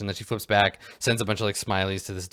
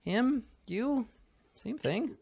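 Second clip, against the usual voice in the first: high-pitched, couldn't-care-less reply; offhand indifference; slower than usual. Acted. Dealer told someone else is paying, high-voiced indifference.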